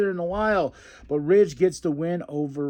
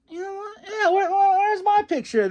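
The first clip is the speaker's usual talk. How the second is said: high, louder mock-tearful whine; a pouty, tear-edged complaint; whiny Austin Theory; 3 dB louder than usual. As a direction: whine like a jealous kid left out. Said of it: "And Austin Theory's like".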